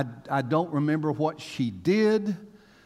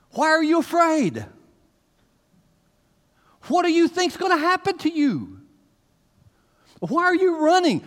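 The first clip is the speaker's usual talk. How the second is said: high, loud pleading questions of Jesus; Jesus questioning us; 12 semitones higher, 7 dB louder than usual. Acted. Jesus challenging fearful listeners, loud and high-pitched. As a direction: challenge the listeners as Jesus would, at full force. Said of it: "this is Jesus looking at us going".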